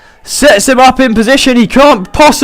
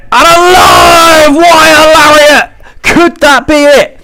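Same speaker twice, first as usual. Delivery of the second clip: rising excitement, higher pitch; excited commentator; 6 semitones higher than usual. Real, not acted. Big move, possible finish.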